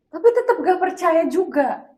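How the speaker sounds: exasperated. Real, not acted. Exasperated about partner's disbelief.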